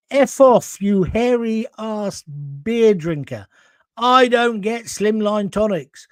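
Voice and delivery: loud, high, drawn-out mock indignation; mock scornful outburst. Host acting out actor friend rejecting slimline tonic, mock scornful. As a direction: imitate an indignant drinker, dragging out the insult.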